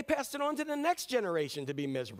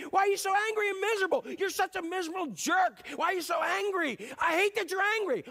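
much higher and louder, scolding fast; hammed-up rage at a miserable jerk; exasperated angry outburst; 11 semitones higher, 4 dB louder than usual. Fed-up person yells back at the miserable angry one.

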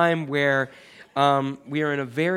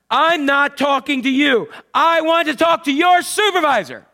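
all-capitals yelling; 10 semitones higher, 7 dB louder than usual. Retold or imitated. Irate caller demands the supervisor, acted all-capitals yelling. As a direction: act out an enraged caller shouting each word. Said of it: "It's all in capitals".

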